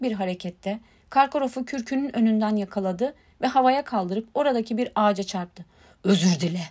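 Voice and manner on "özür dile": growled. A narrator's reading. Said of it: "diye hırladı Hagrid".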